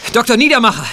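urgent loud call across the house. Acted. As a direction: call him over urgently. Calling out for help urgently.

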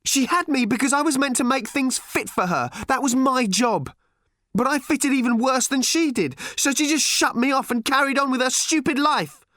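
high, furious rush of accusation; bitter tirade. A narrator's reading. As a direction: pour out hurt anger at a high pitch. Daughter's furious tirade continues.

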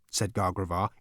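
usual speaking voice

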